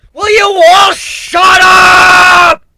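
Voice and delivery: slow, loud sinister bellow; deep booming roar. A narrator's reading. Griffin roars at both voices to shut up, deep and booming. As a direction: drown them all out with a booming roar. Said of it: "My voice became deep and booming".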